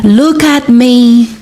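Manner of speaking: slow, high mock scream. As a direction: scream it at her. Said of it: "Zikora screamed".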